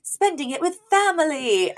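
high-pitched imitation of a little boy; young Maxwell. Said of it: "And he's like".

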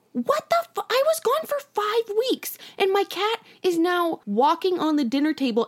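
high-pitched, exasperated retold protest; mock outrage. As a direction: protest in squeaky disbelief. Retold outrage at mom over cat.

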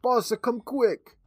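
put-on high-pitched, urgent Jamaican-accented call; terrible Jamaican accent, high and drawn out; yelling Jamaican accent. A narrator's reading. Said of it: "a man burst into the room yelling".

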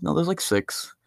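ordinary talk

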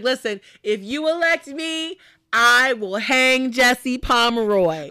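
loud, slow, declaiming politician; mock campaign speech. Candidate promising voters a hanging, hammed-up speechifying.

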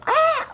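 high scream, tongue forward; open scream on pitch. Shown as a demonstration. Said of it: "So you go".

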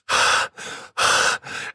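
long, loaded exhales; heavy angry breath. Retold or imitated. Dad with gun out, heavy seething breaths. Imitates dad's silent seething.

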